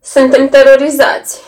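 said through pressed lips; tight lips. A narrator's reading. Daughter looking down adds through tight lips. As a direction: squeeze the words through closed lips. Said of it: "cu buzele strânse".

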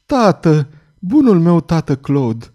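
high, slow, emotional cry to a father; moved girl's voice, drawn out; murmuring daughter. A narrator's reading. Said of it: "Ea întinse brațele și murmură".

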